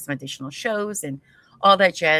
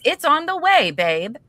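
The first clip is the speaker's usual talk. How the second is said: excited; 11 semitones higher, 7 dB louder than usual. Real, not acted. Excited news to friend.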